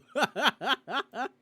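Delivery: high, loud giggling fit; amused laugh. Real, not acted. Laughs after own aside.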